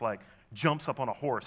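normal talking voice

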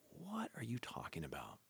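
near-whispered, baffled retort; hushed disbelief. Retold or imitated. Teenager to an over-friendly visiting speaker, hushed disbelief. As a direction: say it under your breath, incredulous. Retold baffled reply to a stranger.

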